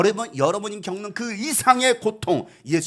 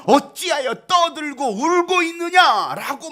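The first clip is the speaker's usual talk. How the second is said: stern rebuke; 9 semitones higher, 8 dB louder, slower than usual. Retold or imitated. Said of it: "예수님은 그들을 향해".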